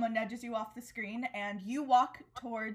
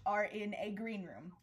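usual speaking voice

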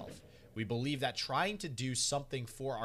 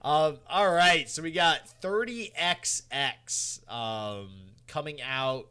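announcer voice; 4 semitones higher, 9 dB louder, slower than usual. Pretend. Co-host apes the announcer voice.

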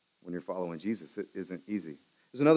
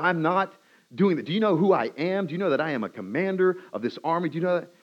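higher-pitched, affronted commander; indignant; 6 semitones higher than usual. Acted. Commander refusing his servants' plea, indignant and offended. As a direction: pull rank with mounting indignation. Naaman refuses, pulling rank.

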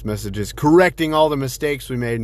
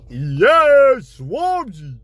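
excited call-out; 11 semitones higher, 4 dB louder, much slower than usual. Real, not acted. Calling out a listener's name.

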